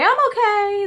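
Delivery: put-on high, perky kid's reassurance; chirpy child. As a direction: bounce back up proudly. Child jumps up after falling.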